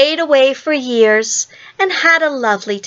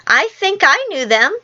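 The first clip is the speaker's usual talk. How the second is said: higher, wavering up and down in doubt; doubtful Michael; 6 semitones higher than usual. A narrator's reading. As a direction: sound unsure, pitch lifted like a small child. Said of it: "said Michael rather doubtfully".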